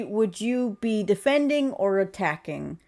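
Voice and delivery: normal talking voice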